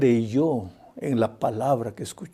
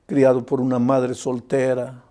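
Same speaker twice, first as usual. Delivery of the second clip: normal talking voice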